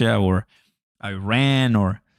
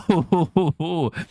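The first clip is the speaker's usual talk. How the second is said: short laugh; 7 semitones higher than usual. Real, not acted. Laughing at Trump quote.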